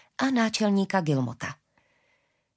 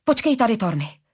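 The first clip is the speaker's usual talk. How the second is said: raised, urgent order that drops on the name; commanding; 4 semitones higher, 4 dB louder than usual. A narrator's reading. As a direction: order him to stay put. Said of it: "přikázala mu". (naléhavý rozkaz)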